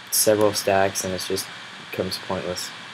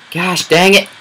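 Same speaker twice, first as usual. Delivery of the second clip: high, loud angry yelp; real annoyed yell; frustrated shout; 9 semitones higher, 11 dB louder than usual. Real, not acted. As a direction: bark it out in frustration. Hit by a mob again.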